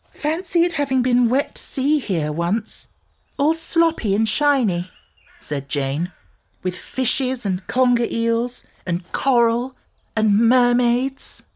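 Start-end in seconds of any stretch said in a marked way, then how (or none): 0.1-4.9 much higher, slow and wondering; a small girl dreaming of the old sea; high wistful child voice; little girl's voice. A narrator's reading. 6.6-11.4 much higher, slow and wondering; a small girl dreaming of the old sea; high wistful child voice; little girl's voice. A narrator's reading. Jane continues.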